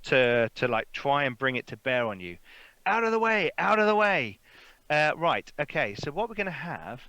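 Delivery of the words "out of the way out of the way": high, raised warning call; yelling coach driver. Acted. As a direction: call out to clear the road. Coach driver aims gun, yells warning.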